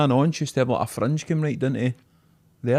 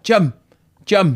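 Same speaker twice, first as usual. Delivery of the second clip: raised, louder mock summons; granddad calling out; 6 semitones higher, 6 dB louder than usual. Imitating granddad calling everybody Jim, raised and loud. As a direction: hail someone across a restaurant. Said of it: "my granddad used to always be like".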